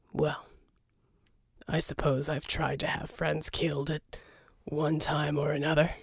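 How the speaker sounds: underplay it, wry and cool; Jack voiced. Jack dry reply in story.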